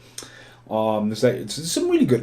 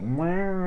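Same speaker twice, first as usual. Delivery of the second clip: mock meow; 10 semitones higher than usual. Elephant in film meows.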